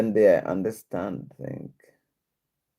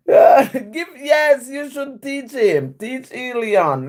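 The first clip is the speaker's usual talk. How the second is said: laughing; 9 semitones higher, slower than usual. Real, not acted. Host laughing as she urges a viewer to teach Elian. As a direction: laugh through the words, egging them on.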